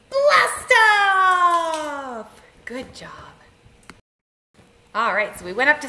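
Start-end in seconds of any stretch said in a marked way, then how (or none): 0.1-2.4 loud, high, long "blast off"; excited launch shout. Shown as a demonstration. Leader launching the children's rocket, excited shout. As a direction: shout the launch and let it swoop down.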